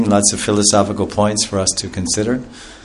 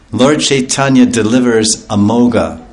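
normal talking voice

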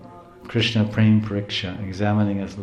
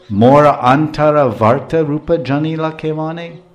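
recited verse; 4 semitones higher, 5 dB louder than usual. Acted. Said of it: "sermon verse recited".

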